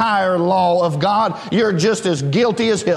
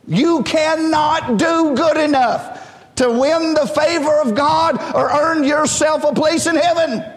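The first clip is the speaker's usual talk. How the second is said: full-force preaching; 6 semitones higher than usual. Acted. Preacher to congregation, who will go to heaven, rising shout. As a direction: lift it up and shout it to the church.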